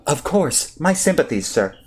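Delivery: lightly voiced polite droid; a hint of a courteous droid. Droid voice answers.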